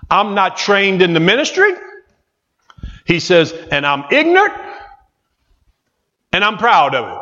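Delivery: high, proud declaration; young man's bold claim. Retold or imitated. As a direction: say it with naive pride.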